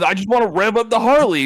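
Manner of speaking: high and eager; eager excitement. Retold or imitated. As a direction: plead to rev the bike. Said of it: "And he says".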